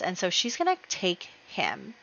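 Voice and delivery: ordinary delivery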